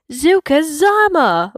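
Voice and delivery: high, loud excited cry; joyful exclamation; exclaimed. A narrator's reading. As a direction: burst out with joy. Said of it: "Bunga exclaims".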